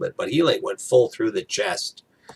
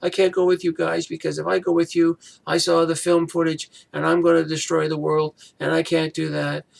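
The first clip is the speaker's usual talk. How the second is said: high, whiny mock complaint; hammed-up whining impression; whiny Daisy; 8 semitones higher than usual. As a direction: mimic a sulky whine, pitched way up. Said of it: "Daisy's turn to be whiny".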